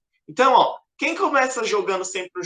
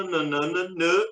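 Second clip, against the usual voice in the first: usual speaking voice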